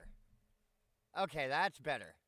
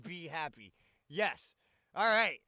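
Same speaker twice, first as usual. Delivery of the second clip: ordinary talk, nothing marked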